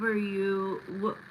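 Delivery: ordinary delivery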